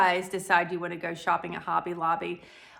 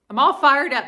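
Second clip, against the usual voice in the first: louder, higher, playfully worked up; mock fired up; 5 semitones higher, 11 dB louder than usual. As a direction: pretend to be all riled up. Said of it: "I'm just kidding".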